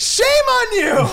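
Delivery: very high, slow, stretched scold; high hammed-up yell; mock yell. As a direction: howl it like a scolding best friend. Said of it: "I love".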